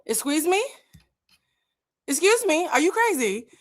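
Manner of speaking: outraged disbelief. Real, not acted. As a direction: shoot the pitch up in pure indignation. Outraged reaction to insult.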